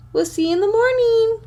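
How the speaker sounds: high, cutesy voice for the dog; speaking for the dog. Pretend. Voicing her dog's goodbye to viewers, high and cutesy. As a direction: give the dog a sweet little voice.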